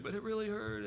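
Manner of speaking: put-on high whiny little-boy voice; imitating crying child. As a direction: mimic a hurt kid complaining to dad. Son insists it hurt.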